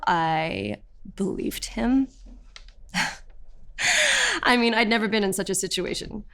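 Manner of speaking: ordinary delivery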